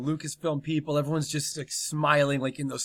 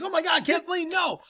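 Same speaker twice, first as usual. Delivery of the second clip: much higher panicked plea; terrified cry; 13 semitones higher than usual. Acted. Director crying out in panic at Kathleen. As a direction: beg her to stop in a panic.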